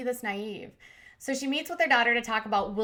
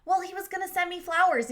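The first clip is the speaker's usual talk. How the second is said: naive mom voice; 9 semitones higher than usual. Retold or imitated. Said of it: "He's like".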